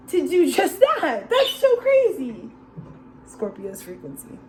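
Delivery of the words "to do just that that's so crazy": high, giggling disbelief; laughing excitedly. Real, not acted. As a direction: laugh through the words.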